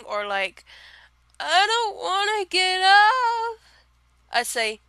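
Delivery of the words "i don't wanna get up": high, slow, drawn-out whine; whiny whine. Retold or imitated. Friend whining she won't get up, high and drawn out.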